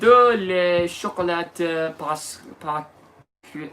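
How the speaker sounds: slow, hammed-up French accent; French with a put-on schoolboy accent; mock French. Answering cohost in French, hammed French accent. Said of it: "if you speak French".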